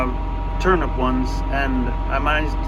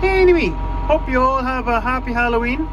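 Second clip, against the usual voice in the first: bright, raised holiday farewell to viewers; cheery sign-off; 9 semitones higher than usual. Real, not acted. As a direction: wish everyone a happy Halloween with a lift.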